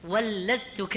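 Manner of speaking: ولدتك with a held double ل; stressed shadda on the lam of ولدتك; heavy doubled lam. Shown as a demonstration. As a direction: say ولدتك slowly as wal-ladtuka. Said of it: "يعني من أمك".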